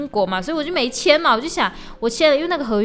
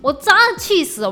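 high-pitched angry outburst; real anger, pitched up; mad to death; 7 semitones higher, 4 dB louder than usual. Real, not acted. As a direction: burst out in exasperation.

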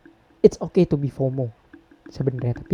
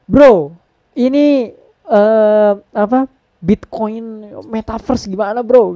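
higher, louder mock hype about crypto; hyped-up bro; 5 semitones higher, 4 dB louder, much slower than usual. Podcast host mocks a friend who only talks crypto, in a loud hyped voice. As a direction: play the overexcited friend pushing Bitcoin talk. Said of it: "Nggak harus ngomong".

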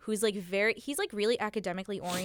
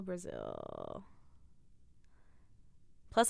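ordinary delivery

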